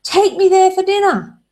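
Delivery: fed-up partner states it plainly; exasperated demand. Retold or imitated. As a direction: finally spell it out. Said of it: "the inferential speaker says".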